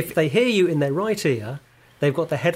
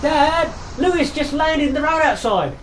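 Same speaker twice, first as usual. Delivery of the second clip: put-on kid voice; 10 semitones higher than usual. Sketch, kid calling to dad.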